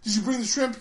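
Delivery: eager hammed-up sidekick reporting in; Aqualad arrives. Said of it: "He's like".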